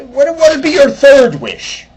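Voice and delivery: high, loud Irish lilt; Irish leprechaun. Retold or imitated. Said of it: "He goes".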